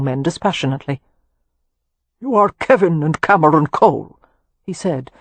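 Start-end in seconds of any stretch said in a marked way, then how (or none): 2.2-4.2 put-on old earl's louder Anglo-Irish lilt, graceful and lightly arid; lightly flowing Anglo-Irish. A narrator's reading. Old Irish earl addressing two young men, flowing Anglo-Irish accent. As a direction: state it with a light, dry Anglo-Irish flow. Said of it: "in flowing Anglo-Irish accent".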